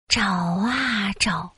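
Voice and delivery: drawn-out, slow refrain; slow searching. A narrator's reading. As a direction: stretch out the search.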